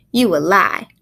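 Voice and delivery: woman's country dialect. Acted. Woman accuses the boy of lying, country dialect. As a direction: call his bluff in country dialect. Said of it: "with the dialogue in the dialect".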